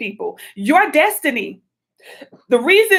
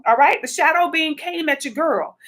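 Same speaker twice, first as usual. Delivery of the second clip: normal talking voice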